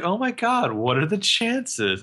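high opening, slow drawn-out finish; mock surprise. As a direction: act out exaggerated astonishment, stretching the end. Acting Jenna's feigned surprise.

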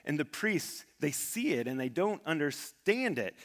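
ordinary talk

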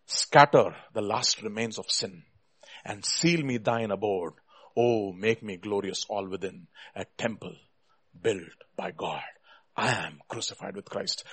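low, subdued hymn reading; reverent prayer. Real, not acted. Preacher praying to be God's temple, reverent. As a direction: let it settle into a quiet close.

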